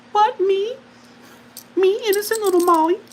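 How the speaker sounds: very high mock-innocent puppy voice; dog's innocent voice. Speaking for Molly, denying she swears: very high mock-innocent voice. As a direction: play the dog as butter-wouldn't-melt innocent. Voicing the dog feigning innocence.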